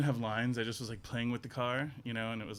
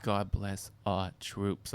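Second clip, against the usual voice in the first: slow, lower mock-solemn mother; imitating mom; 5 semitones lower, much slower than usual. Guest mimicking his mother's solemn Facebook post. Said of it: "My mom was just like".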